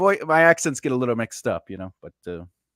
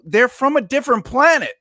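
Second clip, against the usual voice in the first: high, louder mock-exasperated protest; exasperated retort; 9 semitones higher, 6 dB louder than usual. Director retorting to friends about English accents, exasperated. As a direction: hammer it home, pitched up. Retelling argument with voiceover friends.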